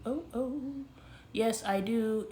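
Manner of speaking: ordinary talk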